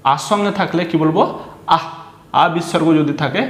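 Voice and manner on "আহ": আহ said short and forceful with a breathy h ending; forceful visarga. Shown as a demonstration. Teacher showing visarga after a vowel, আহ said with force. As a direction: punch out আহ, cut off on a puff of h. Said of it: "এইভাবে জোর দিয়ে বলতে হবে".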